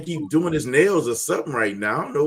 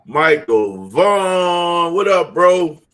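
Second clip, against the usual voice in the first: excited greeting; 2 semitones higher, 7 dB louder, much slower than usual. Real, not acted. Greeting guest loudly.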